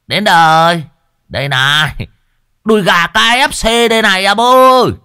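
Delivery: high, loud hammed-up calling; calling like a megaphone. A narrator's reading. Lão Thọt calling the ghost to eat him, loud high calling. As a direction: bait the ghost with mock bravado. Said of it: "nói như cái loa".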